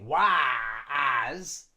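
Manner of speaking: "was" stretched as a long "wa-as"; overly full vowel. Shown as a demonstration. Teacher shows what we don't say, exaggerated vowel. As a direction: draw out a full open A in "was". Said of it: "we don't say".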